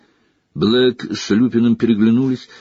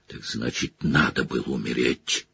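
low, growling fury; growled; 9 semitones lower, 8 dB quieter than usual. Acted. Sirius snarls at Pettigrew, low and growling. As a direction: growl it deep and quiet. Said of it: "прорычал Блэк".

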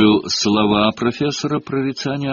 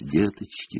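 soft mystic seer; 5 semitones lower, 8 dB quieter than usual. A narrator's reading. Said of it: "приглашала профессор Трелони учеников".

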